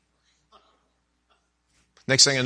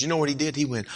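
ordinary talk, nothing marked

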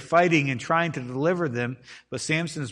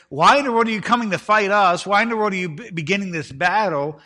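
higher, louder mock-baffled demand; indignant protest; 6 semitones higher, 6 dB louder than usual. Israelites protesting to the Philistines about the coming battle, indignant. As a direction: protest in raised pitch, as if wronged.

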